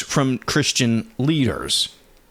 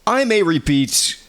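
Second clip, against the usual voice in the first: usual speaking voice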